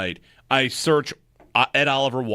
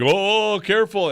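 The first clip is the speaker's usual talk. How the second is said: mock warning; 9 semitones higher, 4 dB louder, slower than usual. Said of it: "people think that that's like a jinx".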